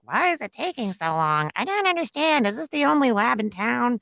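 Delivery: high, whiny mock complaint; mimicking impatient women. Women behind her at the lab complaining about the wait, mock whiny. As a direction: imitate fed-up patients waiting in line.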